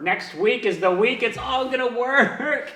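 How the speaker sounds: mock-optimistic self pep talk; mock hopeful mantra. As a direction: hype yourself up with stretched, climbing optimism. Mantra repeated with mounting forced hope.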